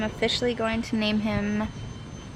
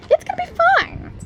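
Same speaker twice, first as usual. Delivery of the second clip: squeaky, fast it's gonna be fine; nervously high reassurance; high-pitched reassurance; 4 dB louder, faster than usual. Real, not acted.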